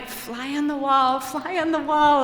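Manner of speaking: high, repeated inner mantra. Retold or imitated. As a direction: chant it to yourself to disappear. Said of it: "back in the corner of my mind".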